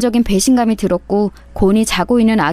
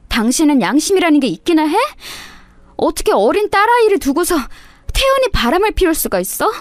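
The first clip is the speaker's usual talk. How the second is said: rising higher, indignant wife; wife accusing her husband of cheating, higher and indignant; angry accusation; 7 semitones higher than usual. Acted. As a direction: confront him, voice climbing.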